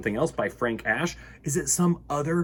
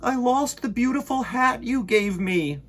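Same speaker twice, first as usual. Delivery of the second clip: Bear's voice; 10 semitones higher, 4 dB louder than usual. Acted. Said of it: "said Bear".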